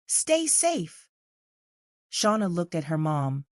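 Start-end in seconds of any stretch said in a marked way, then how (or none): 0.1-1.1 mayor voice. Acted.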